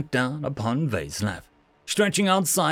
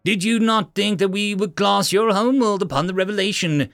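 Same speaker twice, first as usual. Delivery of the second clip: contemptuous; 6 semitones higher than usual. Acted. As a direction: spit the questions with scorn. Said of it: "hissed out in contempt".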